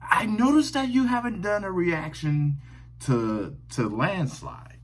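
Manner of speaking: lightly voiced woman commenter, pitched high at first; a hint of a woman's voice, starting high; woman commenter quoted. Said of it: "and she said that".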